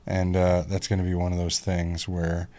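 ordinary delivery

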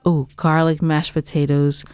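normal talking voice

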